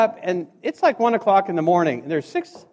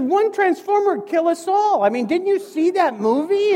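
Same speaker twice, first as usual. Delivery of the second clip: mock alarm; 8 semitones higher than usual. Mock alarm about Decepticons.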